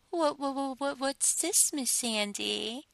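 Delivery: high, mocking "what, what" stammer, slowing on "Miss"; teasing imitation stutter. Mistress imitating her sub's stammer mockingly. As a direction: parrot his stammer back at him. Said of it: "Of course I imitate you and laugh".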